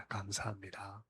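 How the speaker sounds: very soft, gentle thank-you, rising at the end. Shown as a demonstration. A preacher shows how to thank workers, softly and gently. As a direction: thank the workers quietly and sincerely.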